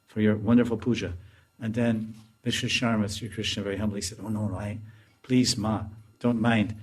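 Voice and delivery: soft hushed storytelling voice; story narration. A narrator's reading. Teacher telling a Krishna story, soft hushed narration. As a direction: tell the pastime gently and quietly.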